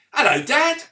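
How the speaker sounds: put-on high, bright young son greeting his dad; son's voice. Son greeting his worried dad, high and eager. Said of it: "his son said".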